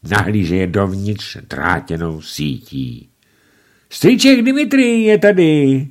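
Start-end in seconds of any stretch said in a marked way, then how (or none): 3.9-5.9 put-on much higher, louder, thrilled child; delighted. A narrator's reading. Dagobert greets uncle Dimitrij, delighted high cry. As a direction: squeal with delight. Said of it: "zaradoval se Dagobert".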